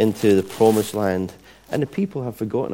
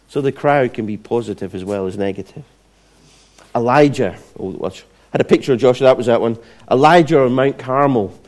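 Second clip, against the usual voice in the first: ordinary talk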